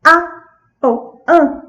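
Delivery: put-on short little vocal bursts, like a toddler asking; tiny one-syllable attempts, imitating a small child; imitating child's sound. Therapist imitating a boy asking for the airplane, short eager grunts. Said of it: "ele simplesmente fez assim".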